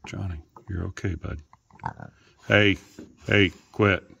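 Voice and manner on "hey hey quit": loud, firm interruption; sharp scolding. Real, not acted. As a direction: break up a pet squabble loudly. Stopping pets fighting.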